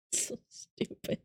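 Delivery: breathless whispered aside; under-the-breath whisper. Real, not acted. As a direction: whisper it to yourself. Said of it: "whispered aside".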